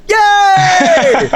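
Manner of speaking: high, drawn-out mock cheer; long, high-pitched "yay" of delight; group cheer. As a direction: imitate friends cheering in a cinema. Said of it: "they all went".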